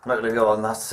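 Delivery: ordinary talk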